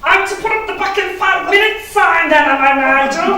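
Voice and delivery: high, loud sarcastic retort; indignant shout. Retold or imitated. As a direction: throw it back at Nigel, fed up. Kevin retorting at Nigel.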